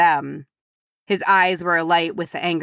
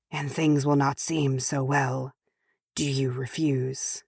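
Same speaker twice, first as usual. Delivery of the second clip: insidious messenger, hushed and threatening; 6 dB quieter than usual. A narrator's reading. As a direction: drop to a quiet, sinister menace. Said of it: "his voice curling insidiously".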